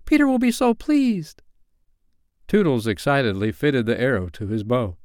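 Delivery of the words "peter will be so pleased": very high, urgent fairy voice; screaming fairy. A narrator's reading. Fairy goading Tootles to shoot, high screamed cry. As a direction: squeal it high to egg him on. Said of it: "she screamed".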